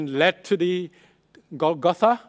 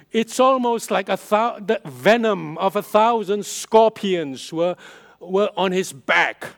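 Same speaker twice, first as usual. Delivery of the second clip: full-force preaching; 3 semitones higher, 7 dB louder than usual. Acted. Pastor driving home the agony of the cross, preaching loudly. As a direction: preach it with rising fervor.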